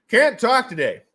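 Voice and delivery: loud, high repeat; mock exasperated outburst. Mock outburst at stumbling over words.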